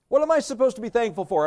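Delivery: high, quick, protesting question; mock exasperation.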